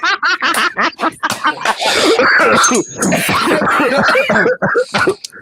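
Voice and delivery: long, high-pitched cackle; real cackling laugh. Real, not acted. Host cracking up at a viewer's comment, high cackle. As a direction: burst into high, helpless laughter.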